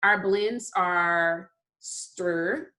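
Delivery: slow, stir sounded out; slow blend. Shown as a demonstration. Teacher sounding out the blend for children. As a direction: stretch out stir slowly. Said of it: "blends that we're gonna".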